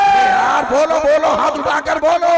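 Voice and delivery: very high, loud call to raise hands; urging the crowd. Real, not acted. Preacher loudly urging the crowd to call out. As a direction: rouse the audience to respond. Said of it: "हाथ उठा कर बोलो".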